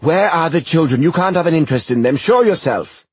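loud, high demanding yell; angry shout. Acted. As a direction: demand answers furiously. Will demanding.

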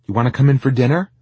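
high, loud, quick invitation; mother calling. A narrator's reading. Mom on back porch inviting son to dinner, calling. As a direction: call him in to eat.

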